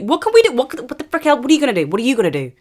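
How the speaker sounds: high, loud, fed-up ranting; exasperated outburst. Acted. Venting at the courts with a loud exasperated yell. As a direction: throw up your hands and yell.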